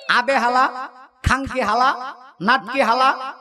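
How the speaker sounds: put-on laughing old Dhaka street talk; amused imitation of old Dhaka speech; old Dhaka slang. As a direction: toss out the hala the Dhaka way, chuckling. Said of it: "এরা সব কথার মধ্যে হালা উচ্চারণ করে".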